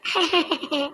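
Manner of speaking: soft rippling chuckle; amused chuckle. Acted. Listener reacting to a boast about looking stylish, soft chuckle.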